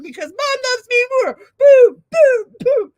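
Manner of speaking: high, loud play-acted tantrum building to booms; mock sibling rage. Sibling punching the doll twin, hammed-up shouting. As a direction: shout it like a squabbling sibling, punching on each word. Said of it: "And she's like".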